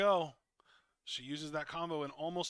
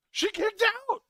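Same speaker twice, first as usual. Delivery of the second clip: high, loud shocked yell; shocked shout; 13 dB louder, much slower than usual. Real, not acted. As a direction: shout it in disbelief. Near fall kicked out live.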